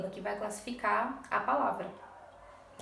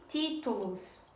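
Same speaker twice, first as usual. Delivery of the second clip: títulos drawn out, TI strongest; slow títulos, leaning on TI; stress on TI; 5 dB quieter, slower than usual. Shown as a demonstration. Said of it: "ti é a palavra mais forte". (títulos devagar, com TI tônico)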